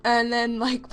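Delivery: usual speaking voice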